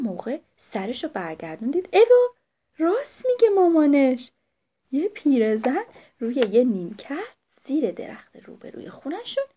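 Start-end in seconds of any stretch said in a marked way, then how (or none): 1.9-4.3 surprised owlet. Acted.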